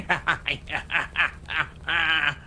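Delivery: unhinged crazy cackle; Joker laugh. Shown as a demonstration. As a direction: laugh like a madman losing it. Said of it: "like, a Joker laugh".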